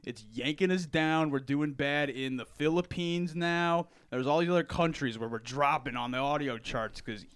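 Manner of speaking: loud Randy Savage wrestling promo; booming wrestler boasts. Acted. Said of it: "sound like Randy Savage".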